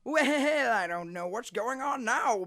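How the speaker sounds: high, loud, drawn-out cartoon "well"; Lord Death. Voice actor testing Lord Death's lines, high and goofy. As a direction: open loud and high, then ease off.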